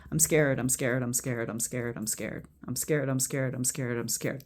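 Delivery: low, soft, run-on repetition; repeated mantra. Shown as a demonstration. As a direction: murmur it over and over as a tapping mantra. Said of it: "keep repeating it over and over".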